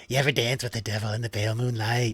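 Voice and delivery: lightly voiced film villain, quiet and quick; a soft, sly taunt, words run together; the Joker. Player offering his only impression to the table, lightly voiced villain. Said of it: "him as the Joker".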